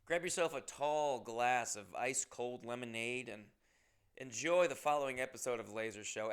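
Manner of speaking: ordinary talk, nothing marked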